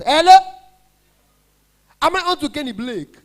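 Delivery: high, loud squeaky hello; imitating the high-voiced Enugu caller; high poor man voice. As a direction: shriek it up high like a broke caller. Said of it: "people that don't have money, their voice is very high".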